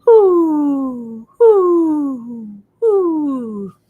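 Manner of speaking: high hooting owl calls. Acted. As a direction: hoot like an owl. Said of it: "do frogs go".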